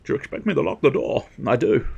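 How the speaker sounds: slurred Scottish impression with shushed s; hammed-up Scottish film star; Sean Connery. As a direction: turn every s into sh, Scottish style.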